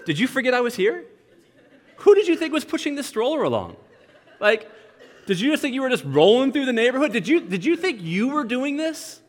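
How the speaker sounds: high-pitched, incredulous dad questions; mock indignation. Dad to son in the stroller, mock-indignant.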